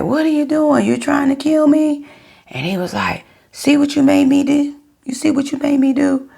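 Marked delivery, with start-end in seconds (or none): 0.0-2.1 high, alarmed yelling back; shrill disbelief at being attacked; frightened cry. Retold or imitated. Woman retelling her panic when her boyfriend threw a glass, high frightened yell. 3.5-6.3 high, loud accusing yell; angry blaming. Retold or imitated. Boyfriend yelling blame at his girlfriend after the glass breaks, high angry accusation.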